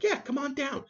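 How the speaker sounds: chirpy high imitation of the lumber guy; high mock clerk voice. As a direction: squeak it up like a cheery clerk. Guy on phone quoted.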